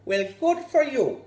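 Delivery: beam approval at the devil; cheery villager. Acted. Villager cheerfully encouraging the devil, loud and bright.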